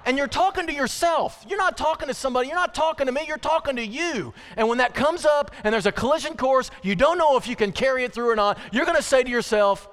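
high, loud pulpit exhortation; impassioned preaching. Real, not acted. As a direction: drive it home to the congregation. Preacher exhorts congregation at full force.

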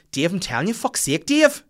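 high, loud fortune teller voice; Psychic Glenn impression. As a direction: squeal it like a wild psychic. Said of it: "Sound like Psychic Glenn there".

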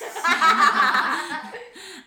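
high, loud burst of laughter; host cracks up laughing. Real, not acted. Laughs at joke.

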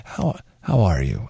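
slow, low earnest how are you; mock sincere greeting. Imitating Glenn greeting someone, slow and sincere. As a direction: attempt a genuinely caring tone. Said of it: "I can't even fake it".

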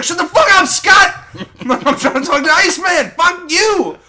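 cartoonish rage at a teammate; mock furious shout. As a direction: scream it at Scott, laughing through it. Logan yelling at Scott, retold.

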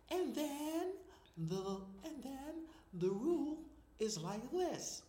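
slow, loud, deliberate mimicry; Margaret Thatcher. Guest shows host the voice after coaching, slow Thatcher imitation. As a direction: imitate a coached politician, drawn out and emphatic. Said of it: "She starts saying like".

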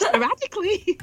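high, giggling sporadically; laughing. Pretend. Trying to use sporadically in a sentence, laughing high. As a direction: blurt the word out, cracking up. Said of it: "I'm gonna try and put it in a sentence".

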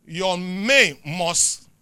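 loud, slow, drawn-out preacher cadence; preaching. Real, not acted. Preacher driving the congregation toward the closing scripture, loud and slow. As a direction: preach it over the music.

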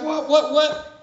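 high, loud baffled what what what; bewildered what. Retold or imitated. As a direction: play the stunned onlookers, pitch jumping up. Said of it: "people are like".

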